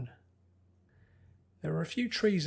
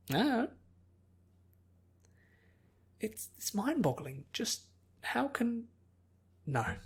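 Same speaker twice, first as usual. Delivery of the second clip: high, halting, lightly voiced bafflement; a bubbly girl stumped; puzzled Pinkie; 7 semitones higher than usual.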